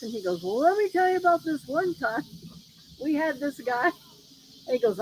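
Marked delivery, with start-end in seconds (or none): 0.4-3.9 higher voice for the boss starting a yarn; imitating boss. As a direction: act out a boss eager to tell a story. Retold boss starting an anecdote.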